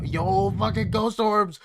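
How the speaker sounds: loud, drawn-out excited yell; excited shout. Real, not acted. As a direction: yell it out, thrilled and slow. Excited at ghost orbs on screen.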